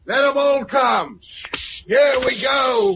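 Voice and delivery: high, slow defiant shout. Acted. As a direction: roar it out as the triffids come. Charging triffids with flamethrower.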